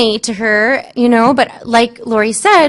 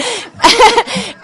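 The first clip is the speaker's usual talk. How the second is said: laughing; 11 semitones higher than usual. Real, not acted. Laughs at the happy outcome.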